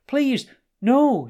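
high, loud, terrified begging; panicked, high-pitched cry; frightened plea. A narrator's reading. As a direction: plead loudly in fear of your life. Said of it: "who was now frightened for his life".

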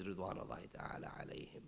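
a soft, breathy whisper of the blessing; whispered honorific. Real, not acted. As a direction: drop to a reverent whisper. Honorific after naming the companions.

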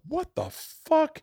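high, slow, drawn-out disbelief; incredulous high squeak at the masks; shocked. Retold or imitated. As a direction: stretch it out, stunned and high. Stunned reaction to masked producers.

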